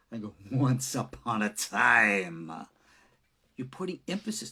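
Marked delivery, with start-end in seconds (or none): 0.5-2.7 "Once upon a time" slow and spooky, louder; scarier telling. Shown as a demonstration. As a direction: draw it out to frighten the listener. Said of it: "if I wanna make it scarier".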